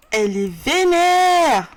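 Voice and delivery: loud, high, drawn-out exclamation; hammed-up exclamation. Real, not acted. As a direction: stretch it out loudly in mock alarm. S'exclame sur la colère du personnage. (exclamation forte, aiguë et traînante)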